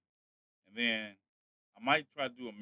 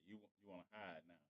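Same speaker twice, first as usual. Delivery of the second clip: usual speaking voice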